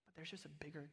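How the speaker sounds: soft whisper, nearly no voice. Real, not acted. Pastor pleading softly with the church, whispered. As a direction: drop to a whisper to draw them in. Intimate appeal to congregation.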